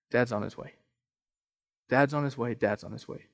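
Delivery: soft refrain. Acted. Pastor lets the refrain settle on the congregation, fading softly.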